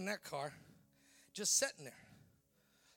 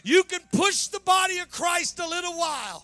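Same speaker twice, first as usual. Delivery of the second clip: shouted, ringing sermon climax, rising higher and louder; full-force preaching; 6 semitones higher, 14 dB louder than usual. Acted. Preacher rallying the church to come out of the cave, thundering. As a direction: preach it out loud to the congregation, building to a roar.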